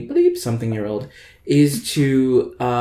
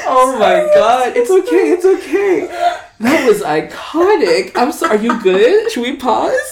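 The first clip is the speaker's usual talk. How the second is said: high, loud, crying with laughter; laughing to tears; 13 semitones higher, 8 dB louder than usual. Real, not acted. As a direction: talk through tears of laughter. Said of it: "I'm crying a little bit".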